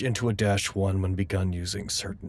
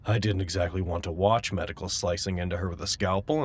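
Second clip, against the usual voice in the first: normal talking voice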